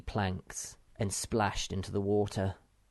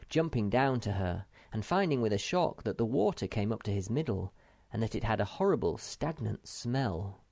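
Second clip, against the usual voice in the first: usual speaking voice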